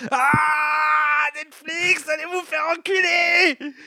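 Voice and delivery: high, shrieking outburst at Netflix; furious shout. Retold or imitated. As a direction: yell it out in rage. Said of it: "j'étais en mode". (cri furieux)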